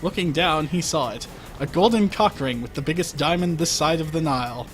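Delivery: normal talking voice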